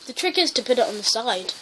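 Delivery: usual speaking voice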